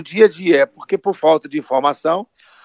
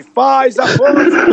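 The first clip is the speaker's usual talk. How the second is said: mock group shout; 7 semitones higher, 5 dB louder, much slower than usual. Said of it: "tá querendo que a gente grite".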